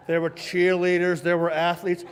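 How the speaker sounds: plain reading voice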